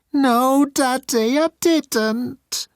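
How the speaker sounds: put-on high, loud whiny child voice; high whining child begging daddy; childish plea. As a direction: beg like a little boy. Grasping at familial bonds.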